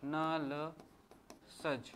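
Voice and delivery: नलसज stretched out very slowly, syllable by syllable; slow memory trick. Shown as a demonstration. Teacher spells out the memory trick for students, very slow and drawn out. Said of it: "एक trick बता रहा हूँ".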